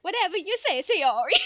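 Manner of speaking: loud, high comic Italian; mock Italian accent. Mascot flips to answer the friend in loud mock Italian accent. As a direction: blare it in a hammed-up Italian accent. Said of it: "flip between a regular anime girl voice and then".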